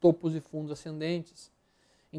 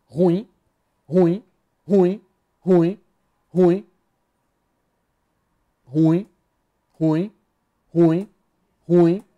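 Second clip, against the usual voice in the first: rapid loud rejections; 9 dB louder, much slower than usual. Real, not acted. Dismissing charts one by one.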